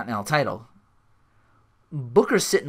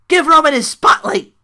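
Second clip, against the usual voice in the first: high, loud promo yell; mock shout; 13 semitones higher, 12 dB louder than usual. Imitating a wrestler calling for the spotlight, shouted. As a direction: belt it out high as a showman's command.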